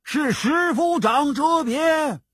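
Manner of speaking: high, drawn-out answering shout; crowd cry. A narrator's reading. Soldiers answering Temujin's question, high drawn-out cry. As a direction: call the name out like massed soldiers. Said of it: "众兵叫道".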